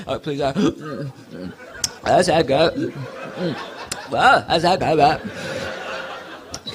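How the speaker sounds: low, slow, overwrought sighing over a drink; intense drinker. Retold or imitated. Friend on a hot day, drinking with low hammed-up intensity. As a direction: imitate someone savouring a drink far too intensely. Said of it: "friends who take a drink ... incredibly intense".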